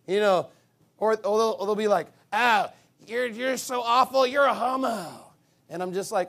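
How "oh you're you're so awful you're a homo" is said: raised, loud mock-outrage; hammed-up scolding; mocking critics. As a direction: imitate an indignant accuser, slowly. Acts out critics' insult mockingly.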